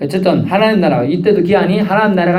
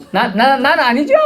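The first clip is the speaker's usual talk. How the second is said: higher, stuttering 나, 나, 나는 in a flustered rush; stammering denial; 10 semitones higher than usual. Retold or imitated. A frightened disciple denying betrayal, stammering higher. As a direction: trip over the first word in nervous panic. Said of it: "제가 그때 어감을 얘기하는 거예요".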